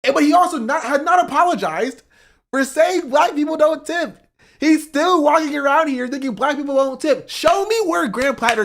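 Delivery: loud, high-pitched outraged rant; heated rant. Real, not acted. Streamer ranting at chat, loud and outraged. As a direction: let the outrage boil over. Angry rant about the candidate.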